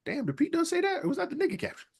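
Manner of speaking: high, soft falsetto disbelief; acting out own confusion, very high and soft; puzzled reaction. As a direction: squeak out a hushed, puzzled question.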